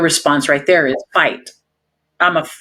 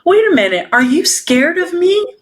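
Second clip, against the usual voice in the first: hurt surprise; 11 semitones higher than usual. Retold or imitated. Officer realizing the driver fears her, surprised. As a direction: realize with a pang that she fears you. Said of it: "I was like".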